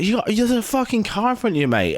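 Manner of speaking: high-pitched acted alarm; mock confrontation. Other guy to the lorry driver, acted alarm.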